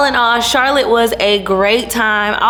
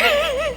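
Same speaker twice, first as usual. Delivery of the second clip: squealing high laugh; real delighted squeal of laughter; 5 dB quieter than usual. Real, not acted. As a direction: burst into a high giggle. Laughing at own entrance.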